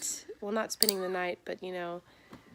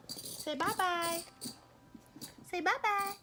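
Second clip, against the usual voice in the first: put-on high, sing-song talk to a baby; mother coaxing her baby to say bye-bye, sing-song; 5 semitones higher than usual. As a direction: coo at the little one, high and sweet.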